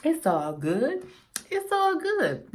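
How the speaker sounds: slow drawn-out quote; movie quote. Pretend. Quoting a film to viewers, slow and drawn out. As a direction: drag out the movie line. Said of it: "Nobody know what movie that's from".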